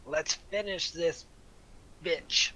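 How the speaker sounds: slow, punchy words of encouragement; rally your comrade, loud and drawn out; encouraging rally cry. Acted. Player's character urges Dio on in the fight, encouraging. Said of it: "like, encouraging words".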